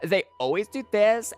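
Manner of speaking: put-on valley girl voice. Shown as a demonstration. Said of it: "because, like".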